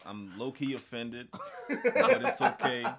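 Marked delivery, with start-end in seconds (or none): 1.7-2.8 laughing. Real, not acted. Mock offended, laughing.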